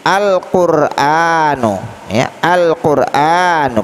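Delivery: very slow "Al-Qur'anu", l distinct, long a; clearly read al. Shown as a demonstration. Teacher models the example twice, "Al-Qur'anu" with clear l. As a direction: sound the l fully and stretch the a in "Al-Qur'anu". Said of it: "alnya kita baca dengan terang".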